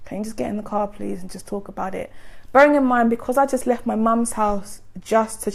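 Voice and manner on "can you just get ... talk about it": gentle pleading. Retold or imitated. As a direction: imitate yourself asking calmly and quietly. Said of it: "I have quite a gentle voice".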